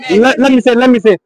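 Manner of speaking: ordinary talk, nothing marked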